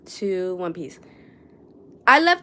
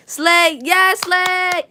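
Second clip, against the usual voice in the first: loud, higher hype cheer; excited slay; 9 semitones higher, 6 dB louder, much slower than usual. Real, not acted. Admiring crocheted figure.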